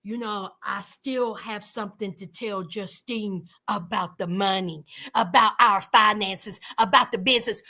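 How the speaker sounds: a hint of Michael's low serious tone; low mock Michael. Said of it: "and he was like".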